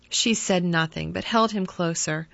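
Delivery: ordinary delivery, nothing marked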